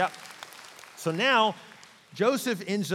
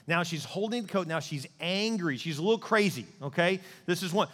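usual speaking voice

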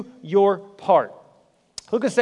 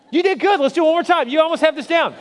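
excited praise; 8 semitones higher, 5 dB louder, faster than usual. Real, not acted. Praising congregation, laughing.

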